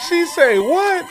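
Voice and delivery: high, loud stunned reaction; surprised shout. Real, not acted. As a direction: react in shock. Reacting to her callout.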